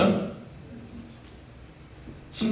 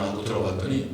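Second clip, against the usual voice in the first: normal talking voice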